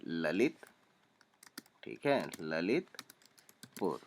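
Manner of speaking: usual speaking voice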